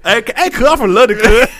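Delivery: high, loud hammed-up London slang voice; mock London accent. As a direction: ham up a London street accent, cuz. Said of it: "talk like this".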